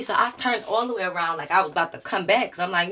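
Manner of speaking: ordinary talk, nothing marked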